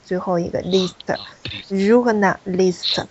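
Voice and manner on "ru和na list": ru和na list slowly, as three units; syllable by syllable. Shown as a demonstration. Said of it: "三个音节，ru和一个na".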